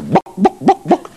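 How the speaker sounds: high, bright hen clucking; chicken clucking. Retold or imitated. Punchline chook clucking at the frog in a high hen voice. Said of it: "looks the frog dead in the eye and says".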